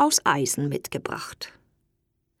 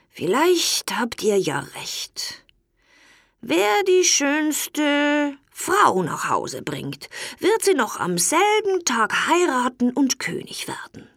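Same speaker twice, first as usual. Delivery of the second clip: a lightly voiced weary old king, higher and slow; tired old king; 7 semitones higher than usual. A narrator's reading. Old king yields to his sons, lightly voiced and weary. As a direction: give in gently like an old, weak king. King sets third test.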